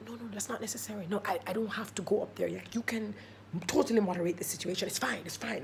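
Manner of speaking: soft, hurried excuses under the breath; quietly wriggle out of it; hushed protest. Retold or imitated. Storyteller begging off going up front, hushed protest.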